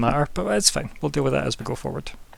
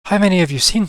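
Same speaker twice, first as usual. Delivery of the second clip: put-on higher and louder, suspicious old man; 7 semitones higher, 6 dB louder than usual. Old man turns on the party, loud and suspicious. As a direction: probe them, distrustful.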